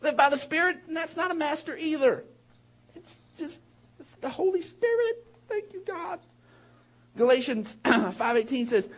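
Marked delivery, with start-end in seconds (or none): 3.0-6.2 moved to tears mid-sermon; choked up. Real, not acted. Pastor thanking God, choked with tears. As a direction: voice breaking with emotion.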